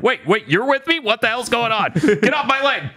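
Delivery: high-pitched mock panic; startled outburst. Acting out a character confronted by Denji, startled outburst.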